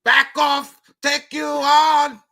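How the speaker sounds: loud, high, slow macho growl; tough-guy driver. Retold or imitated. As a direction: play the road-rage tough guy. Acts an aggressive driver.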